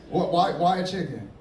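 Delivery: ordinary talk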